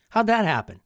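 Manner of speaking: ordinary delivery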